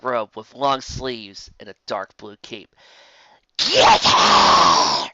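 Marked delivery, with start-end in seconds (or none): 3.6-5.1 low, loud villain shout; screeching enchantress. Acted. Evil enchantress orders guards to seize the Pegasus, shouting. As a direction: bark the order at her guards. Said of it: "screeched Torka".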